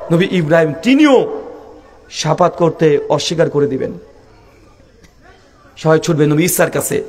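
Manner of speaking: ordinary talk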